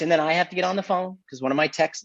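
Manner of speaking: ordinary talk, nothing marked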